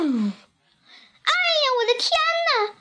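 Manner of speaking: put-on high-pitched startled old woman; acted squeal of alarm from a little old woman; frightened old woman's cry. A narrator's reading. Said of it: "瘦小的老妇人喊起来".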